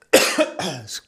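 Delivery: sharp cough on a drink; choking cough. Real, not acted. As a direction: cough as water goes down wrong. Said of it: "I'm choking up here".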